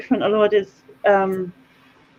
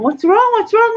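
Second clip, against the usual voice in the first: high, urgent imitated dad asking; worried dad; 13 semitones higher, 4 dB louder than usual. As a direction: mimic a dad on the motorway asking what's wrong. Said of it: "he's going".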